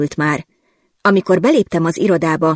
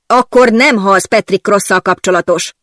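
booming; 3 semitones higher, 4 dB louder than usual. Acted. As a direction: thunder the correction. (harsogva, dörgő hangon)

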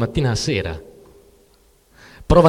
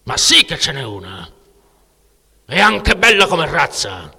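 rising to higher and louder; Artur insisting; 6 semitones higher, 4 dB louder than usual. Acted. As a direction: insist stubbornly and climb up. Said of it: "insisteva lui". (insistenza testarda, voce che sale)

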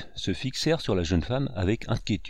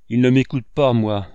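louder, indignant reply; loud retort; 3 semitones higher, 8 dB louder than usual. A narrator's reading. Aunt Sarah snapping back at the brigadier, loud. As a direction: throw it back at him. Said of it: "répliqua la tante Sarah".

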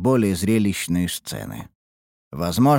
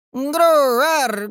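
high, slow kitten-like mock roar; beast roar; 4 dB louder, much slower than usual. A narrator's reading. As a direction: roar like a tiny monster. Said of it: "изобразила она звериный рёв".

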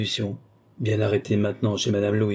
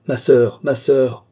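raised, higher call for attention; 4 semitones higher, 7 dB louder, slower than usual. A narrator's reading. Princess summoning the nun, calling out. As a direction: call out urgently to summon someone.

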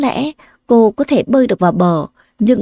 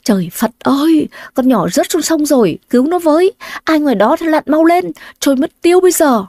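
panicked shouting; 6 semitones higher than usual. Acted. Villagers shout in panic for a rescuer, frantic. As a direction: cry out in a frenzy for someone to dive in. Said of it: "hoảng loạn nhao nhao lên".

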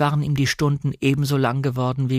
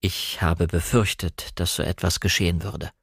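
measured, lower character voice; Frank; 6 semitones lower than usual. A narrator's reading. Frank gravely admits his fear.

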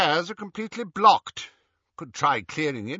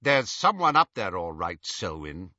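sharply; 6 semitones lower than usual. A narrator's reading. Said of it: "said the second man sharply".

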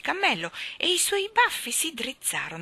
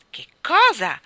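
loud, higher-pitched outburst from the king; shout; 5 semitones higher, 12 dB louder than usual. A narrator's reading. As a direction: shout it in royal disbelief. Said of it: "gridò il re". (grido del re)

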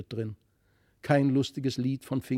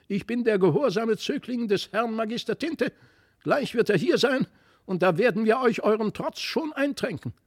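ugly quacking doll; 8 semitones higher than usual. A narrator's reading. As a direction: squawk like a nasty doll risen from the reeds. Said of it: "mit hässlicher Stimme quäkte".

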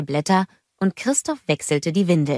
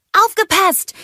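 very high, loud warning shout; calling out; 6 dB louder than usual. A narrator's reading. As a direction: clear the way, racing past. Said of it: "rief Anna". (hoher, lauter Warnruf)

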